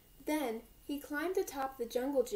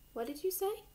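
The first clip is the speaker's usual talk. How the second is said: gentle mother voice; 8 semitones higher than usual. A narrator's reading. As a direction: ask tenderly like a loving mom. Mother gently asks son.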